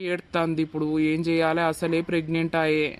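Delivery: a troubled murmur no one should hear; worried aside. Acted. Husband fretting to himself over his crying wife, softly.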